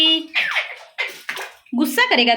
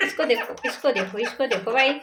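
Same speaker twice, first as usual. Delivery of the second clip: hushed; 6 semitones lower, 8 dB quieter than usual. Real, not acted. Hushed while watching the parrot.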